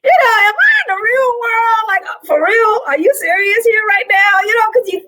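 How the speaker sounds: very high-pitched, comic disbelief; tickled incredulity. Retold or imitated. Newcomer reacting to real New York accents, tickled and incredulous. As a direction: squeal your amazement, replaying how tickled you were. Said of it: "it tickled me 'cause it was unexpected".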